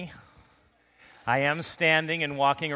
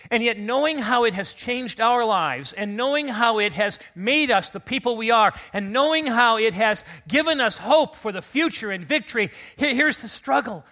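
louder and higher with each clause; building fervor; 6 semitones higher, 6 dB louder than usual. Real, not acted. Pastor preaching to church on Easter, louder and higher. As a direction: build the sermon to its peak.

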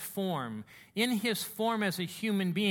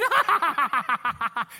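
high, loud burst of laughter; exultant laugh; 8 semitones higher, 9 dB louder than usual. Acted. New pilot after first solo, exultant laughing. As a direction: laugh wildly in triumph.

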